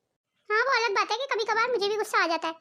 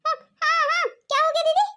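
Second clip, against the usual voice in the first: startled awake; 6 semitones higher, 3 dB louder than usual. Acted. Bhabhi woken from sleep.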